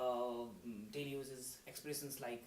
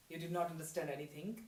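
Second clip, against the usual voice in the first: usual speaking voice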